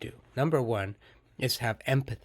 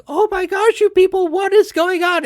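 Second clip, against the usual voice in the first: much higher and louder, at wit's end; exasperated shout; 12 dB louder than usual. Retold or imitated. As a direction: yell in disbelief at a fighting pair. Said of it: "I'm like".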